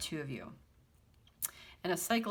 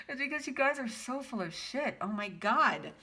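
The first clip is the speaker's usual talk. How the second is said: higher, amused laughing talk; 7 semitones higher than usual. Real, not acted. Reader teasing the couple, laughing higher. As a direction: laugh it out, half scolding. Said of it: "okay, but why I'm laughing".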